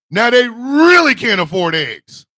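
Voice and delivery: ordinary talk, nothing marked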